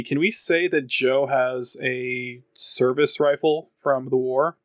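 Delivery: ordinary talk, nothing marked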